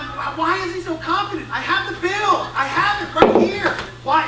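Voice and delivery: high-pitched baffled ranting; exasperated disbelief. Retold or imitated. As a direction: protest in exasperated bewilderment. Dad baffled by son's confidence.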